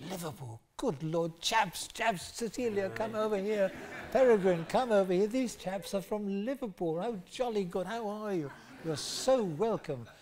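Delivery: high-pitched, gushing posh caricature; posh delighted host. Retold or imitated. Posh host calls friends over to meet Liverpool chaps, posh delight. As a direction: call everyone over in mock-posh delight. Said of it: "posh people, and lovely".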